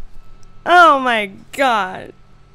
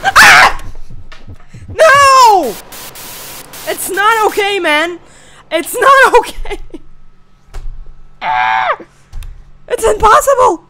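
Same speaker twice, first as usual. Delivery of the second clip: 1 terrified screaming; 8 semitones higher, 8 dB louder than usual. Real, not acted. Jumpscare in horror game.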